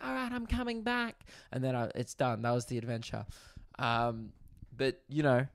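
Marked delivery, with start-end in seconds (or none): 0.0-1.3 high put-on voice; chirpy high call back; mock cheery. Calling out to the house in a high cheery voice. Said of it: "I'm like".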